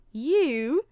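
high, loud, incredulous burst; stepmother's yell. A narrator's reading. Stepmother to Cinderella about the ball, yelling. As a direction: yell it in disbelief. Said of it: "yelled the stepmother".

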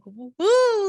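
high, loud cheering woo; excited woo. Retold or imitated. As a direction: whoop it with delight. Acting out a happy reaction.

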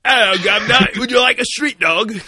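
high, loud, hammed-up British accent; loud failed attempt at a British accent; bad British accent. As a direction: shout it like a failing fake Cockney. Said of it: "I gotta stop trying to do a British accent".

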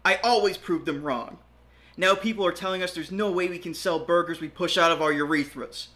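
high, fast shout over the wind. Acted. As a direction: yell over roaring wind. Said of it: "Edward shouted over the rushing wind".

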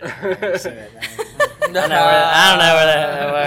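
laughing. Real, not acted. Laughing joke about coworker.

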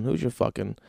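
normal talking voice

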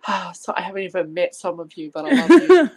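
rising into a loud laugh on the last words; laughing affection. Retold or imitated. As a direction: gush at the cousins' kids through laughter. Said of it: "and I'll be like".

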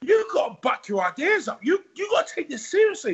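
high, shouting trainer telling him off; tough trainer. Retold or imitated. Trainer scolding the lazy fighter, high and shouting. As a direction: bark at him like a Rocky coach. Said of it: "It was like a Rocky movie".

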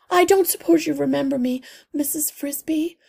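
a small worried mother clinging to hope; anxious mother mouse. A narrator's reading. Mother mouse pleading with the old healer, high and anxious.

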